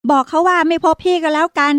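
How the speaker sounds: put-on a sharp high retort from an annoyed boy; snapping. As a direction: snap back at his little sister. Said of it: "ยงชัยตวาด". (เด็กชายตวาดใส่น้องสาวเสียงสูง)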